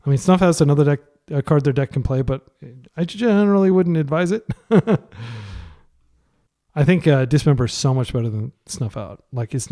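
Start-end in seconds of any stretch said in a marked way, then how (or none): 3.0-5.0 laughing. Real, not acted. Joking aside about a card.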